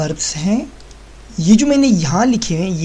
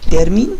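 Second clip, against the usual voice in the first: Termin said loud and punchy; emphatic word; 8 dB louder than usual. Shown as a demonstration. Teacher stresses Termin for the new word. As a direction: hit Termin hard and clear. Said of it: "Termin बोलते हैं".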